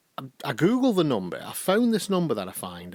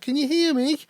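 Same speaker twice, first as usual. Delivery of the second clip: imitating Kathleen checking the line; 4 dB louder than usual. Kathleen on bad phone line.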